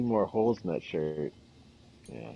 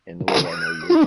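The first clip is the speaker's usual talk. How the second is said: words cracking up into real laughter, much louder and higher; can't hold it in, laugh through the words; 14 dB louder than usual. Real, not acted. Teasing a friend about having kids, breaking into loud laughter. Bursts out laughing mid-tease.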